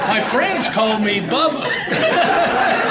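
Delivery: slow, stretched Southern drawl, amused; hammed-up slow Southern drawl on Bubba. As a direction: drag out Bubba like a Southern good old boy. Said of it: "I love their Southern drawl".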